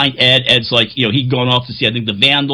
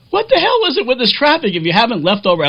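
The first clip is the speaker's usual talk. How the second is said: friend Steph; 11 semitones higher, faster than usual. Retold or imitated. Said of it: "Steph is texting me, like".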